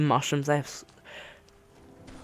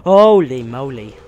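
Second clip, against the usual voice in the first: amazed; 10 dB louder than usual. Real, not acted. Amazed at the view.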